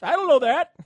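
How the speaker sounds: high, loud laughing yell; hammed-up high shout through a laugh; mock Python shout. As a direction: bellow it like the sketch character. Repeats Python line, shouting, laughing.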